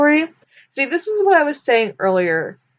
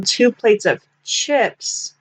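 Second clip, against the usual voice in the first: slow, drawn-out "chips"; slow weight on "chips"; stress on chips; slower than usual. Shown as a demonstration. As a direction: lean on the word "chips" and stretch it. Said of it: "I'm emphasizing the word chip like this".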